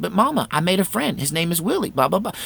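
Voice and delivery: put-on pleading child. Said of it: "I said".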